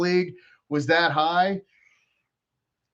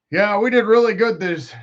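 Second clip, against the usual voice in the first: lightly hammed-up boastful fan, louder and higher; mock proud fan; 4 semitones higher, 6 dB louder than usual. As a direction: imitate a fan bragging about the team. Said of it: "the people that are like".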